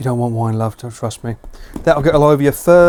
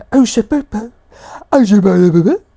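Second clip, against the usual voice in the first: pet voice; 7 semitones higher, 3 dB louder than usual. Real, not acted. Streamer babbling affectionately at the dog, high pet voice. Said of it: "Pet voice".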